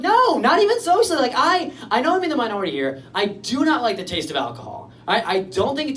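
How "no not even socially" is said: high, emphatic denial; exasperated insistence. Retold or imitated. Patient answering the nurse's doubt, exasperated insistence. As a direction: insist on it, pitched right up.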